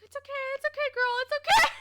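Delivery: high, loud squealed reassurance; laughing imitated consoling. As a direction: squeal the comforting words through laughter. Imitates manager consoling her, laughing.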